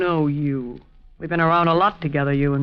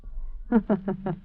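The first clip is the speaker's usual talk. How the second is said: gloating laugh; 7 dB quieter than usual. Acted. Dolores laughing off the murder to Al, smug chuckle. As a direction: laugh it off coldly. Gloating over the dead man.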